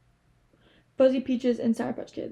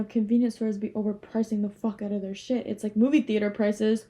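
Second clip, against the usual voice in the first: normal talking voice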